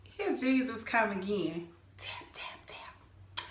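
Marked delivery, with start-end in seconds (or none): 2.0-3.5 soft unvoiced whisper, tap tap tap; whispered sound effect. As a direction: breathe the taps like a secret nudge. God tapping her shoulder.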